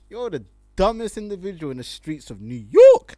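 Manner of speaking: loud mocking roast. Real, not acted. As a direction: blast him with the insult. Roasting the guy directly.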